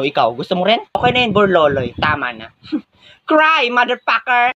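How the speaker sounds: usual speaking voice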